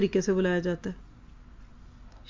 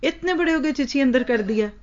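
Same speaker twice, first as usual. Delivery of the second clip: इतने pitched high, a sharp parental taunt; mother taunting her grown child, mock-scolding; 3 semitones higher than usual. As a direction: jab at the child like an exasperated mother. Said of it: "ये ताना देते हैं".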